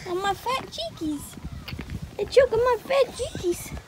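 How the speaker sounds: ordinary talk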